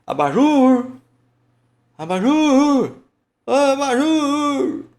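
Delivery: very high, loud, held last syllable; long, chanted holler on JUR; singsong gate call. Shown as a demonstration. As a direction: sing it out as if calling someone at the gate. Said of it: "dá uma cantadinha pra chamar no portão".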